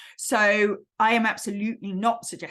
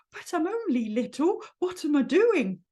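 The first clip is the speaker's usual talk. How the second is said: small, higher, worried voice; Piglet; 5 semitones higher than usual. Acted. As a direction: fret at being too little for this. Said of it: "you get your Piglet going".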